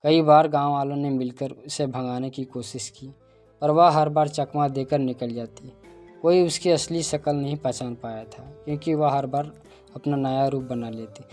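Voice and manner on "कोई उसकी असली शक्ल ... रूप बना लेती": usual speaking voice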